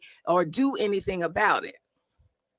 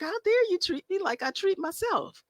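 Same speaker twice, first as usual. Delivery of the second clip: high, outraged mock protest; a hammed-up how-dare-you; mock indignant; 12 semitones higher than usual. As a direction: play the offended one, pitch way up. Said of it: "It's like".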